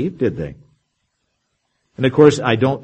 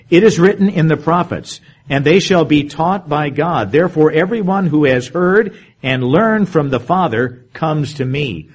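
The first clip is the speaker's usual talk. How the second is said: scripture reading; 3 semitones higher, 3 dB louder than usual. Acted. Reads verse forty-five.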